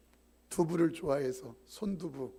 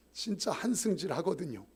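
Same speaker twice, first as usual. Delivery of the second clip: ordinary talk, nothing marked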